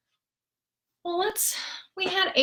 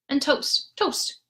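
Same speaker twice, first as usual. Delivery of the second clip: ordinary delivery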